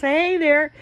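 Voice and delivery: high, loud hey there; cheerful greeting. Retold or imitated. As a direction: answer back brightly and high. Gina greeting back.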